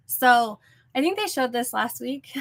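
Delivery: ordinary talk, nothing marked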